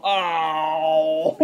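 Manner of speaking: long, drawn-out mock "oh"; mock dismay. Streamer acting out a reply to the pedophile question, mock dismay. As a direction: stretch it out in hammed-up reaction. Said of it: "And I go".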